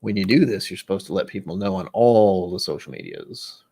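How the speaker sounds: ordinary talk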